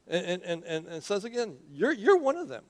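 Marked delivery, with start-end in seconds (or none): 1.7-2.6 put-on high-pitched accusing servant girl; insistent accusation. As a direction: accuse him, voice jumping up. Voices girl accusing again.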